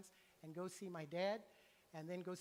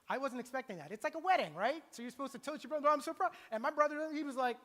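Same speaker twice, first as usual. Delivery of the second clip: loud, higher, worked-up storytelling; excited retelling; 6 semitones higher, 9 dB louder than usual. Retold or imitated. Pastor telling the congregation about the wedding toast, loud and excited. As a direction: build the shock of the wedding toast.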